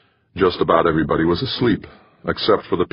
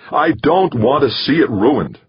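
heated; 8 semitones higher, 4 dB louder than usual. A narrator's reading. Jamie still shouting at Dex.

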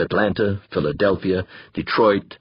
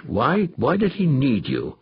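slower, pressing repeat of the question; insisting; 4 semitones higher than usual. Acted. Danvers presses Molina again, slow and insistent. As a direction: lean on it, refusing to let it drop. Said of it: "Danvers insisted".

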